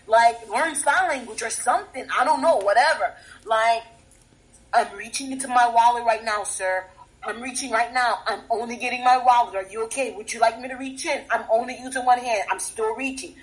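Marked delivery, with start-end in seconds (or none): none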